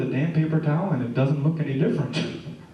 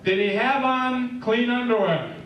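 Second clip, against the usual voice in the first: mother's question; 6 semitones higher, slower than usual. Retold or imitated. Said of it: "she asks the doctor".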